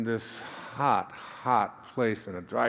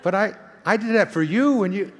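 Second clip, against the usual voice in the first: indignant dad; 9 semitones higher, 7 dB louder than usual. Pretend. Imagined kids refusing to help.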